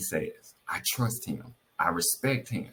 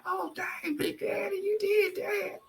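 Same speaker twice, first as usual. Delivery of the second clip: very high, softer squeal; playful gushing; 3 dB quieter, slower than usual. Retold or imitated. Wife reacting to husband's surprise chair delivery, playful high-pitched gushing. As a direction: gush in a tiny high voice, stretching the last words.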